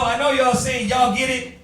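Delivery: ordinary talk, nothing marked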